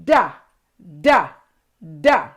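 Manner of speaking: "Duh" with the tongue behind the teeth, pushed out hard; D sound demo. Shown as a demonstration. Teaching kids the D sound, "Duh" pushed hard. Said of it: "Watch my mouth".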